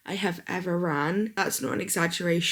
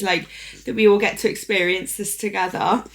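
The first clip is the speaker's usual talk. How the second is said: ordinary talk, nothing marked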